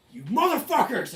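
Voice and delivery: high, loud furious shout; enraged prisoner. Acted. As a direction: hurl the curse at your captors. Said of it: "he's like".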